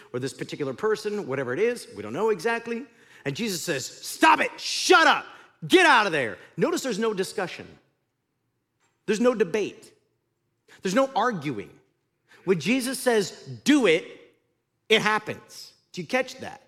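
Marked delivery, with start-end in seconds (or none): 3.9-6.4 higher, louder commanding shout; shouted rebuke. Acted. Jesus rebukes demon. 13.7-14.1 firm command. Acted. Jesus's command quoted.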